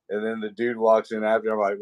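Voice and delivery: ordinary talk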